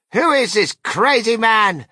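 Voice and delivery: high, loud, drawn-out shriek; outraged old grandma at high pitch; screamed outrage. A narrator's reading. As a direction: screech the question in horror. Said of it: "screamed Grandma Josephine".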